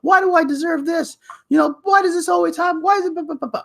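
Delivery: very high-pitched retold yell; high wailing yell of protest, tailing into blahs. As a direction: re-enact crying out at the heavens, pitch way up. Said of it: "But I just yelled out".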